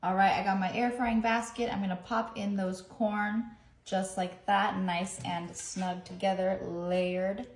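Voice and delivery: ordinary talk, nothing marked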